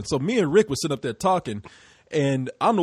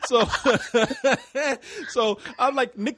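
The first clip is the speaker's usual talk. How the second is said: ordinary delivery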